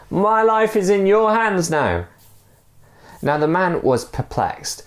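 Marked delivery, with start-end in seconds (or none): 0.1-2.1 high shouted call; shouted reminder. Acted. Stranger shouting up from below, high firm call. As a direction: call up firmly. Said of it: "shouted the other man".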